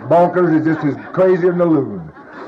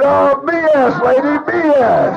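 scornful outburst; 13 semitones higher, 3 dB louder, slower than usual. Retold or imitated. Heckling the speaker at meeting.

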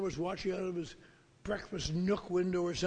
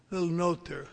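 ordinary talk, nothing marked

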